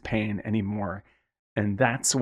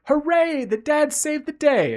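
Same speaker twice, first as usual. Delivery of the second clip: much higher and slower, a hammed-up hooray; mock cheer; 4 dB louder, slower than usual. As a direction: fake relief, cheering like a naive kid. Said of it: "and you're like".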